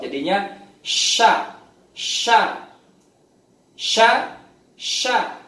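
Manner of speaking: sya repeated, the sh rising in strength; sya drill. Shown as a demonstration. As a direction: repeat sya, air spreading. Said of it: "suaranya molor". (latihan sya berulang)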